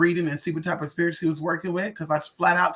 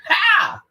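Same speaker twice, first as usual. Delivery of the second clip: scoffing ha; 13 semitones higher, 7 dB louder than usual. Real, not acted. Mocking scoffing laugh.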